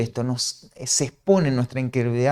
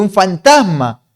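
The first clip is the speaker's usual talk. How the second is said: loud, raised cry of alarm while reading aloud; exclamación de miedo; 5 semitones higher, 9 dB louder, slower than usual. Acted. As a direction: read it out as a startled, louder exclamation. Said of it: "dieron muchas voces de miedo". (exclamación asustada, más fuerte y aguda)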